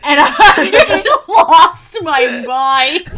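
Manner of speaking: laughing hard, high and loud; cracking up mid-sentence. Real, not acted. Recalling a funny moment.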